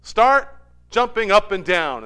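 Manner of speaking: game leader command. Retold or imitated. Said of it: "And then I say".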